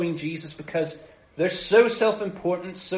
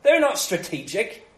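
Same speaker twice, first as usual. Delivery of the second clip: dismissive; 6 semitones higher, 3 dB louder than usual. Retold or imitated. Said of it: "have the attitude of".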